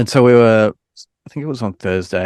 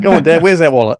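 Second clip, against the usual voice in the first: loud, higher mock-teen demand; demanding kid impression; 7 semitones higher, 8 dB louder than usual. Daughter asking Dad for shop money, playful demanding impression. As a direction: lightly play a kid pestering for cash. Imitates older child wanting money.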